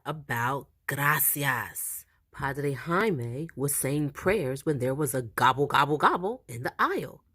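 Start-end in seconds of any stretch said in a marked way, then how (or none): none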